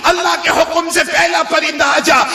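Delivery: high, ringing summons; high, insistent call. A narrator's reading. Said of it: "آواز دی".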